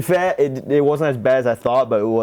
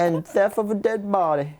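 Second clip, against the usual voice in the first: hammed-up Southern lawman reading charges; amateur attempt at a Southern US accent; American Southern accent; 9 semitones higher than usual. Shown as a demonstration. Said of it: "American South one".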